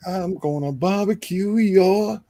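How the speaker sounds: high, drawn-out movie sheriff threat; Jackie Gleason sheriff. Acted. Co-host quoting the film's sheriff, slow high impression. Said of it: "the sheriff, uh, Jackie Gleason".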